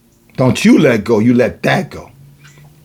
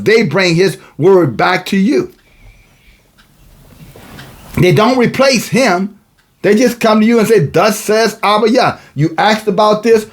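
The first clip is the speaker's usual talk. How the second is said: raised, higher pitched sermon delivery; preaching; 7 semitones higher than usual. Acted. Preacher reading verses to the congregation, raised preaching voice.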